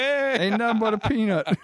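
high hype bodybuilder call; Ronnie Coleman impression.

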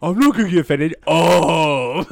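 high, loud mock protest; hammed-up offended cry; mock outburst. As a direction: shout it in put-on indignation. Mocking the other's likely offense.

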